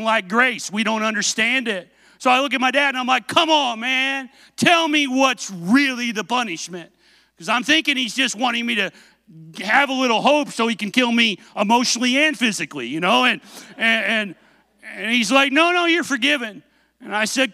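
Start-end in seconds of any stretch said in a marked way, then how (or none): 3.3-6.9 high-pitched incredulous protest; disbelieving plea. Retold or imitated. Son pressing his dad for the real punishment, disbelieving plea. 15.5-16.6 higher-pitched, brushing off the protest; mock fatherly insistence, pitched up; insistent reassurance. Dad refusing to punish his son, insistent.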